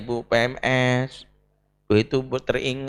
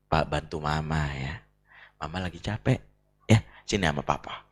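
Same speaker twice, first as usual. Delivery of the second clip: gentle father; 11 semitones lower, 7 dB quieter than usual. Retold or imitated. Husband gently calling child away.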